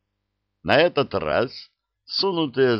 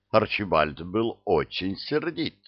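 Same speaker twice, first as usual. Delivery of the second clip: ordinary delivery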